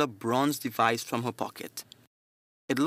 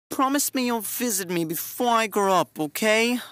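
put-on child voice; 9 semitones higher than usual. A narrator's reading. Child character voice.

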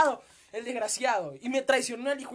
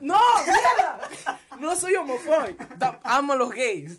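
laughing protest; 4 semitones higher, 9 dB louder than usual. Real, not acted. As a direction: burst out laughing in denial.

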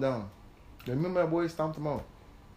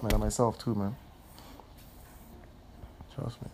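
normal talking voice

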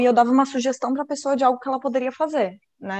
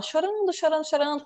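a touch of playful blubbering; mock tearful; 4 semitones higher, 4 dB quieter than usual. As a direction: mimic someone crying on and on. Miming the elephant's endless crying.

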